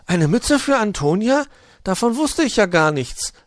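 put-on a small boy thrilled by news; high, loud kid voice, delighted; excited little hedgehog. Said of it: "rief er begeistert".

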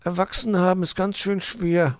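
high little hedgehog voice; mildly glum, high child voice; Borstel. A narrator's reading. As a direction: grumble like a small child, pitched high. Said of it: "murmelte Borstel".

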